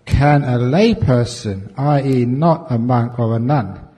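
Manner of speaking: slow, loud formal reading of a letter; reading the question. Shown as a demonstration. Monk reading a listener's question aloud, slow and formal. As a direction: read it out slowly to the hall. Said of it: "Now we come to the questions".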